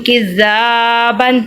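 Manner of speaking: کزابن with a long "zaa", short "ban"; lengthened middle vowel. Shown as a demonstration. Teacher modelling the lengthening rule, drawn-out middle vowel. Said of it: "اس کو ہم لمبا کرتے ہیں".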